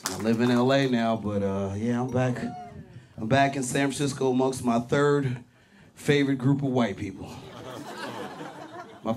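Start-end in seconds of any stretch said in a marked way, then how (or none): none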